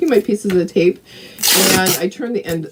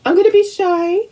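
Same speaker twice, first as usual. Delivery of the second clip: high little shy dog voice; voicing the dog; 11 semitones higher than usual. Voicing her dog's shy reply, high playful. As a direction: speak for the shy pup.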